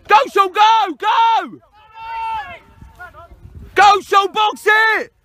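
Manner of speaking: real excited coach's holler; urging shout. Real, not acted. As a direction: spur the runner on from the touchline.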